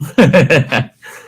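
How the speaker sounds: loud high burst of laughter; big laugh. Real, not acted. Laughing at his brother's dark joke.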